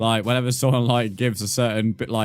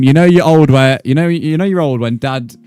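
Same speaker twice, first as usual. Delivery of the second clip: loud reading; 3 semitones higher, 12 dB louder than usual. Real, not acted. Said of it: "I read that wrong".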